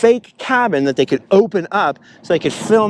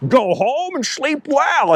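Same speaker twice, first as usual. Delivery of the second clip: slow, much higher, drawn-out line; hammed-up misquote; 8 semitones higher, much slower than usual. Imitating friend's over-the-top misquote, slow and high. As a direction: stretch it out like an over-the-top movie quote. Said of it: "quoting it wrong".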